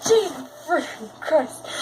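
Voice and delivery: mock fright, drawn out; startled exclamation. Gamer turning to find a ghost, startled. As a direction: retell a jump scare, slowly gasped out.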